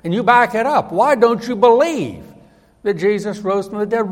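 acted indignant questioning; forceful demand. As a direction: press hard for an answer. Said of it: "he showed respect, but he could have said".

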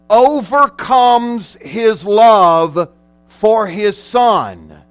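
slow, loud, high preaching with weighty pauses; emphatic preaching. Acted. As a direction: preach it big, stretching the key words. Preaches at full force.

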